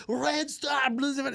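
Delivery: put-on high, heavy Serbian-accented bartender cheer. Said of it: "had a very heavy accent".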